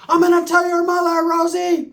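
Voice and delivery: high, hammed-up threatening cry; movie quote impression. Quoting La Bamba while others watch, high mock yell. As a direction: wail it like an overacted film line. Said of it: "It was like the movie La Bamba".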